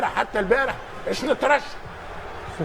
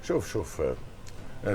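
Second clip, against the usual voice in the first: quiet; 12 semitones lower, 11 dB quieter, much slower than usual. Real, not acted. Sudden drop to hushed voice.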